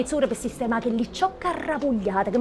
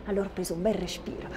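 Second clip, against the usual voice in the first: ordinary talk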